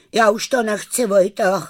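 high, mildly shivery call; chattering with cold. A narrator's reading. Kája calls to Vojta after falling through ice, shivery cry. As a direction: call out as if freezing wet. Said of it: "když drkotavě zavolal".

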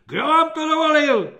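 high loud outraged shriek. A narrator's reading. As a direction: keep screeching. Corporal keeps shrieking.

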